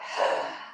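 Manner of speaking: long audible breath out; demonstrated exhale. Shown as a demonstration. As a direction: let the breath whoosh out. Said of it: "And then let it out".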